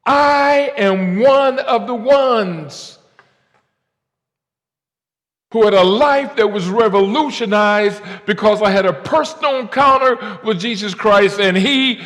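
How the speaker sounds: high, loud, slow testimony; preaching at full force. Acted. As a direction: proclaim it like a revival preacher.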